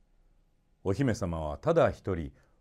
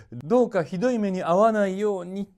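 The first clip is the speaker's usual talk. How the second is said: townsfolk praying; 10 semitones higher, 5 dB louder than usual. A narrator's reading. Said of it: "とお祈りをしてくれました".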